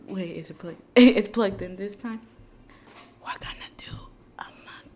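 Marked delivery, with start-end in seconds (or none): 3.2-4.9 soft breathy ASMR whisper. Acted. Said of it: "automatically go A S M R".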